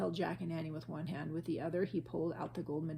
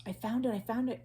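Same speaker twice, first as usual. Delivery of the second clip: higher excited call; excited cry; 5 semitones higher, 3 dB louder than usual. A narrator's reading. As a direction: shout the find with joy. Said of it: "cried Jack".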